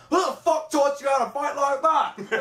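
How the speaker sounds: high-pitched, slow imitated yell; incredulous put-on holler; mock scolding. Acted scolding in a practice scene.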